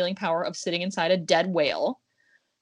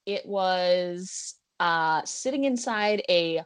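slow dramatic reveal; much slower than usual. Acted.

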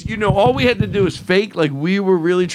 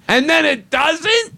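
surprised; 9 semitones higher, slower than usual. Real, not acted. Punchline of the story.

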